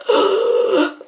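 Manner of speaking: sharp loud gasp of shock. Retold or imitated. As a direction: gasp like a jump scare just hit. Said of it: "I was just like".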